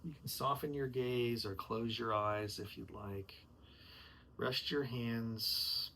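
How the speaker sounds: slow, soft yoga instructor calm; guided yoga voice. Acted. Rehearsing yoga teacher guides class into breath, slow and soft. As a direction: ease the class into stillness, slowly.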